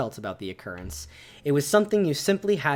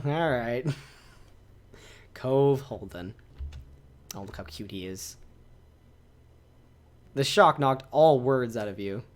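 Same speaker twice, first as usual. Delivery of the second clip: ordinary talk, nothing marked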